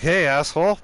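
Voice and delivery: confrontational shout. Retold or imitated. Teller confronting an inmate on the phone, confrontational shout. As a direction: call him out sharply and loudly.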